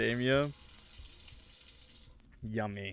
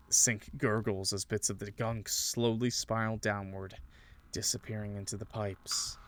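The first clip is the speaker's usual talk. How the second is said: ordinary delivery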